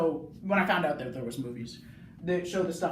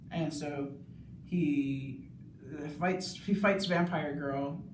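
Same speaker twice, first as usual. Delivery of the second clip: ordinary delivery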